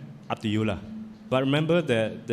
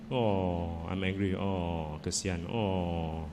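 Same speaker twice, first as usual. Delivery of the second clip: soft, low cooing comfort; tender self-soothing; 5 semitones lower, 6 dB quieter, much slower than usual. Shown as a demonstration. Speaker showing children how to comfort their anger, soft and tender. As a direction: gently hush your own hurt like a child's.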